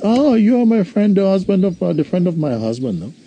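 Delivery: high, thin woman's voice, rising in surprise; widow's voice. Retold or imitated. Widow greets her husband's friend, high surprised voice.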